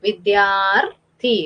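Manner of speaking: വിദ്യാർത്ഥി very slowly, long "dyaar" held before "thi"; R before final syllable. Shown as a demonstration. Teacher demonstrates the R sign to pupils, വിദ്യാർത്ഥി slow and split before "thi". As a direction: draw out വിദ്യാർത്ഥി, landing the R before the last syllable. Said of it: "മുന്നേ നമ്മൾ ഋ എന്ന് pronounce".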